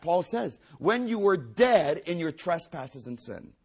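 high, loud fervent preaching; full-force preaching. Real, not acted. Preacher pressing home the Greek words, loud and fervent. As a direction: drive it home to the congregation.

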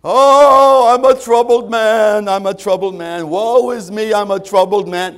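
loud, high mock lament; exaggerated woe-is-me complaining; poor moaning. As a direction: wail it out as a hammed-up troubled man. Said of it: "Jacob does this poor moaning".

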